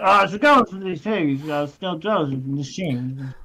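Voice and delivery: "Oh, it's going for these things" mumbled in thick broad Aussie. Retold or imitated. Locals on the train, mumbled broad Aussie accent. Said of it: "little bit like".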